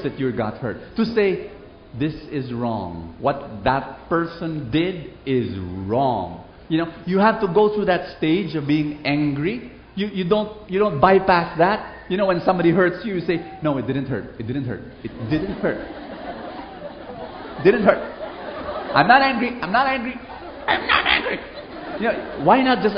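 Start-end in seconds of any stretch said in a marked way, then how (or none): 2.0-6.4 slow firm statement. Shown as a demonstration. Modelling acknowledging hurt. 13.6-21.4 mock denial. Shown as a demonstration.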